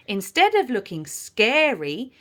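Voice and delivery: ordinary delivery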